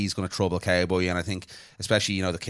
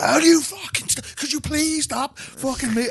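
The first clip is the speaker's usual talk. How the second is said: much higher, louder mock rant; retold outburst; 4 dB louder, slower than usual. Retelling yelling at the man on the flight, high mock yell. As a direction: screech it like you lost it. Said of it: "And I just went".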